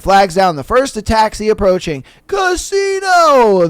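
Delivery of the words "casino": high, very drawn-out booming call; booming yell. Acted. Voice repeats its order by the taxi, booming yell. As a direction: holler it slowly from on high. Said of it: "the voice repeats".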